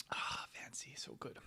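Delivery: breathy whisper of delight. Real, not acted. Savoring new coffee alone, soft whisper. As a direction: whisper it like a treat.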